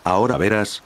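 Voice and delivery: plain reading voice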